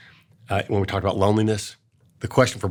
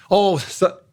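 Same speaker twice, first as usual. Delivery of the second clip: mock breezy brush-off; 5 semitones higher than usual. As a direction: wave the kid's sadness away cheerfully.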